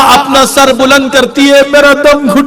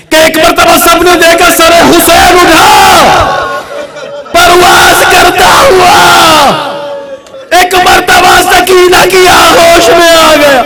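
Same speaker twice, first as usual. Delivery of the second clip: wailing lament; 4 semitones higher, slower than usual. Acted. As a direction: cry the story out to the mourners. Climactic lament of the martyr's head.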